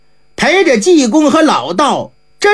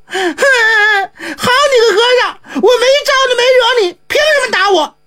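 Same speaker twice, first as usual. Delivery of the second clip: put-on high child's voice, crying and yelling; a slapped boy wailing his complaint; 10 semitones higher than usual. A narrator's reading. Slapped boy crying at the monk from outside, high and tearful. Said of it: "连嚷嚷带哭".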